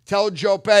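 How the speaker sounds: very loud, scornful retort down the phone; loud brush-off. Retold or imitated. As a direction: dismiss a prank call at full volume. Retold brush-off of supposed celebrity call.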